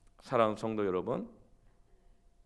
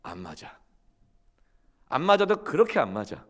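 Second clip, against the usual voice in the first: usual speaking voice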